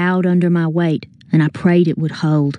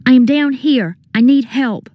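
high, urgent call for rescue; crying out; 7 semitones higher than usual. A narrator's reading. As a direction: call up for help in a raised pitch. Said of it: "I cried out".

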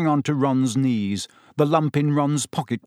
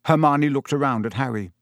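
usual speaking voice

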